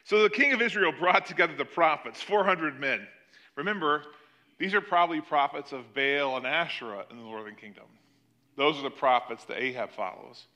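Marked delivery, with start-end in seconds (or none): none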